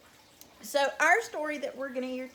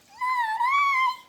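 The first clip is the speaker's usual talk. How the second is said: very high, loud squeak; squeaky mouse; 8 dB louder than usual. A narrator's reading. Mouse refusing to help the hen, high squeaky voice. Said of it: "said the mouse".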